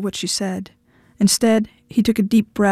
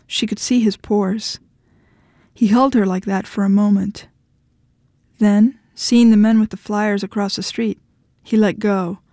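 ordinary delivery, nothing marked

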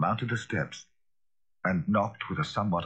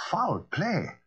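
startled, higher echo; a touch of fright, higher; frightened cry; 11 semitones higher than usual. A narrator's reading. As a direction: echo it in alarm. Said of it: "cried the lawyer, a good deal frightened".

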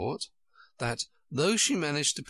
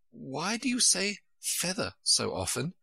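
put-on higher-pitched, mildly vexed girl; voiced little girl, a bit put out; rather vexed; 6 semitones higher than usual. A narrator's reading. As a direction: ask with a touch of exasperation. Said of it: "rather vexed".

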